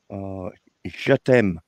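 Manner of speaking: je t'aime said slowly and carefully in French; French pronunciation. Shown as a demonstration. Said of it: "you gotta say it right".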